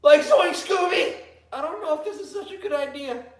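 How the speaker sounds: hammed-up Shaggy, high pitched; Shaggy impression. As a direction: whine it like a scared cartoon stoner. Scooby-Doo impressions.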